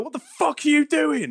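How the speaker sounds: high-pitched, outraged mock yell; retold shouting. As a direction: re-enact shouting at someone in disbelief. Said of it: "I was shouting at them".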